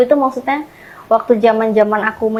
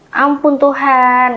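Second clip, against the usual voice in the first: loud, drawn-out exclamation, higher; dismayed father; 4 semitones higher, 4 dB louder, much slower than usual. Retold or imitated. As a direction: imitate a dismayed father crying out to God, slowly. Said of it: "Nah, itu papa langsung".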